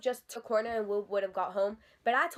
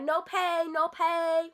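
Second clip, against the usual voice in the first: high, slow, insistent chant; mock her demand, stretched out and high; mimicked salon worker; 5 semitones higher, much slower than usual. Teller imitating the salon worker demanding payment, mocking mimicry.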